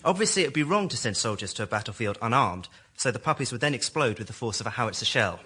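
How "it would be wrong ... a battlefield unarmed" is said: ordinary delivery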